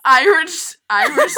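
loud, fumbled Irish accent; laughing try at Irish; attempted Irish accent. Shown as a demonstration. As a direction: practice a fake Irish accent loudly. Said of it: "I forget how to talk".

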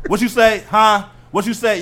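loud, high repeated demand; mock-stern dad pressing for an answer; confrontational dad. Dad challenges son busy gaming, loud confrontational questioning.

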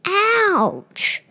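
sharp high cry of pain; pained ouch. Acted. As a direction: yelp as if just hurt. Crash in the bike race.

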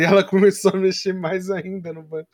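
ordinary delivery

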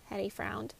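ordinary delivery, nothing marked

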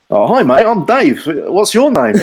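high, bright mock-friendly hello; chirpy high greeting. Dave introduces himself to the silent stranger, high and chirpy. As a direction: pipe it up like an eager new mate. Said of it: "and someone says".

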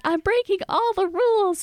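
high-pitched, half-joking inner panic; mock alarm. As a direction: retell a worried thought, pitched well up. Acts out her alarmed inner thought.

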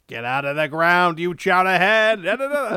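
put-on loud, gruff yell at the kid; angry father yell. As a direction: bark it loud like a dad. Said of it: "He's like".

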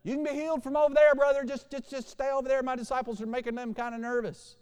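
high, fast, loud call easing off; calling across. Retold or imitated. Mock Jesus keeping the leper over there, high calling out. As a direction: call over to someone far away, high-pitched.

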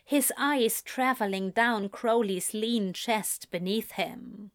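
ordinary delivery

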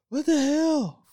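high shocked what the hell; shocked outburst. Retold or imitated. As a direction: play it as startled disbelief. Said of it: "some of us are like".

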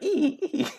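very high imitated dolphin squeaks; mock dolphin chatter; dolphin squeal. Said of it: "a dolphin, you know, noise".

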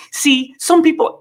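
high, sing-song teacherly mockery; mock schoolteacher. Commentator mimicking the leader talking down to viewers. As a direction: talk down like a kindergarten teacher. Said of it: "watch it one more time".

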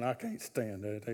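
muttered inner complaint. Retold or imitated. Believer hiding a grumble behind a smile, muttered. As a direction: grumble it privately to yourself.

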